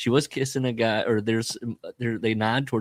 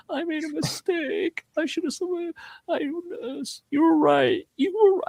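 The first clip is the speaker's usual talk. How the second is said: imitating a regretful girl. Imitating the girl's regretful call.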